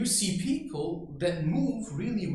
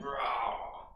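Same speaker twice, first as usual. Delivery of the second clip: wild roar; 5 semitones lower than usual. Shown as a demonstration. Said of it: "I have phases where I'm like".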